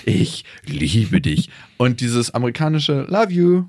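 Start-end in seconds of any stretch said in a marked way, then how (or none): 0.1-1.5 slow, low, weighty "Ich liebe dich", every word set down heavily; heavy solemn love vow. Retold or imitated. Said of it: "wie so ein Schwerlasttransporter". 3.1-3.6 casual love you. Retold or imitated. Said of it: "ist so wie so ein dreieinhalb Tonner".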